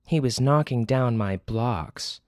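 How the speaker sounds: a stern mother explaining; scolding mother. Acted.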